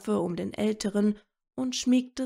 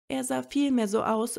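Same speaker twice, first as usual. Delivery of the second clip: ordinary delivery, nothing marked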